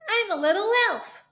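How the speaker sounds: high, forward, squeaky elf; little elf voice. Shown as a demonstration. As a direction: sound like a tiny elf. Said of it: "pull their ears".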